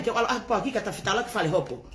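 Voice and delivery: ordinary delivery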